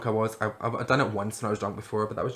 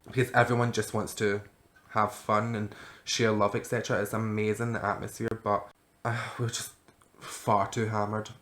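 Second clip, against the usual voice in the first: usual speaking voice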